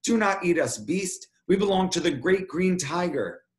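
high-pitched desperate protest; crying out. Acted. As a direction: cry out to ward off a beast. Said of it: "I cried out".

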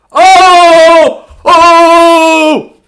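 two high, loud shouts; excited shouts. Real, not acted. Breaker shouting at a huge card, high and loud. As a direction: cry out at the pull, twice. Reacting to a big pull.

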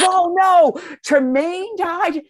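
high, loud cry of shock, then a slow question; hearing that Tremaine died; shocked husband. Retold or imitated. Husband learns Tremaine died: loud, high shocked cry. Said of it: "And he goes".

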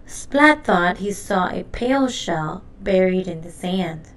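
usual speaking voice

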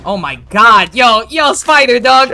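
excited yelling. Real, not acted. Excited over teammate's play.

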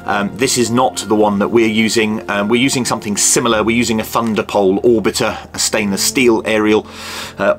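ordinary talk

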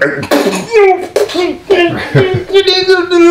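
high, long spluttering cackle; imitated helpless laughing. Partner cracking up eye to eye, spluttering laughter. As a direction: imitate someone failing to hold it in. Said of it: "laughing 'cause it was just eye to eye".

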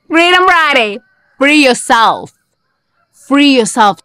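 high, ringing call to be free; excited slogan. Real, not acted. Cheering on viewers on Freedom Friday, high rally call.

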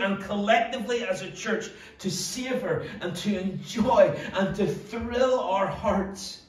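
impassioned preaching. Acted. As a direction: preach it from an aching heart. Passionate sermon opening.

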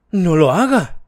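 lightly voiced anxious widow; frightened widow. A narrator's reading. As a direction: plead with her not to lock it. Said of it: "dijo la señora Maltravers".

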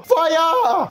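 mock launch command, high and bright; playful high shout. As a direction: yell it like firing a cannon. Launching oven like rocket.

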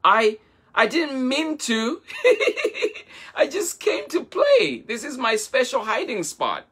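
put-on high, laughing apology from a baby hippo; high giggly hippo, sorry but amused; giggling. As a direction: giggle through it, playful. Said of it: "giggled Himondo".

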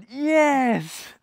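high, loud, drawn-out yes; joyful shout. Acted. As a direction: shout it out in triumph. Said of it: "And I shout".

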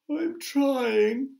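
high, drawn-out little creature voice; poor glowworm. A narrator's reading. Glowworm answering the crowd's demands, high and plaintive. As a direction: plead in a high, put-upon voice. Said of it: "answered the poor glowworm".

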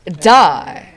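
loud burst on one word; mock attack shout. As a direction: shout it as you strike. Attacking in game.

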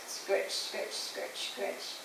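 soft, voiceless scratchy words; whispered scraping effect. A narrator's reading. Father pulled across kitchen floor, whispered scraping effect. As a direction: whisper each word like a scrape.

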